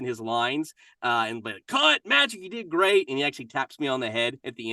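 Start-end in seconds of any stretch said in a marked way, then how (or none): 1.7-3.1 high, loud, upbeat director's call; commercial director calling cut. Acted. Actor playing a director to Magic Johnson in a commercial, high called-out praise. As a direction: call out praise across a film set. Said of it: "where I was the director of the commercial".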